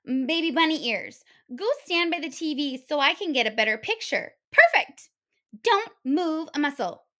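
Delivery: a pushy guest barking orders at her hosts; bossy spoiled big girl. Acted. The big little girl bossing the hares for pillows, high and loud.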